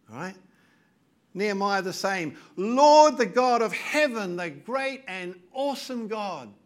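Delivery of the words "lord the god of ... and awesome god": loud, crying out to God; fervent prayer cry. Retold or imitated. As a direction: call out the prayer with full force. Preacher voices Nehemiah's prayer.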